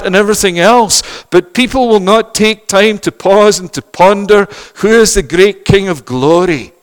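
loud, high fervent preaching; full-force preaching. Acted. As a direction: lament the tinsel and glitter with fire.

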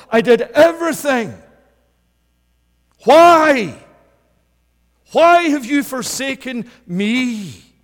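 Jesus on the cross crying out loud, forsaken; anguished cry. Acted. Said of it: "Jesus himself said and prayed".

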